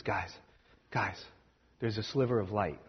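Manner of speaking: soft, low, urgent murmur to the others; barely above a breath, don't startle them; hushed wake-up call. Acted. Traveler nudging sleeping friends in the cave, hushed and urgent.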